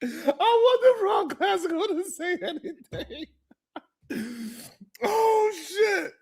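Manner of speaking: slow, high, laughing till near tears; gasping words between laughs. Real, not acted. Host losing it laughing about the big head call.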